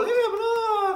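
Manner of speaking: high, loud cheer with a laugh; mock celebration. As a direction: squeal it like a delighted quiz host. Mock-celebrating a right answer.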